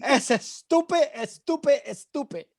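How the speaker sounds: put-on Spanish accent, pitch leaping up then dropping. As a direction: exclaim it high in mock-indignant Spanish. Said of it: "I'll say it in an accent".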